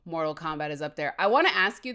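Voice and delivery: ordinary talk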